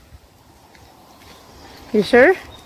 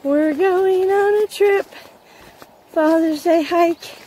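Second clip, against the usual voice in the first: excited announcing; 8 semitones higher, slower than usual. Real, not acted. Announcing the family hike.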